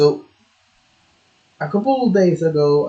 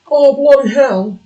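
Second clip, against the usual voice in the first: high, loud mock British exclamation; lightly hammed-up British accent; 11 semitones higher, 7 dB louder than usual. Streamer jokes about British English, mock British accent. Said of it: "because it's British".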